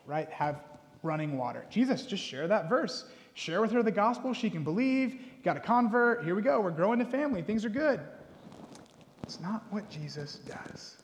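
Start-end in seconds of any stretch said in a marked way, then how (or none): 1.7-8.0 higher, louder tongue-in-cheek cheering; sarcastic. Retold or imitated. Preacher sarcastically urging Jesus to just convert her. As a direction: sell it with mock enthusiasm. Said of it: "hopefully you caught my sarcasm".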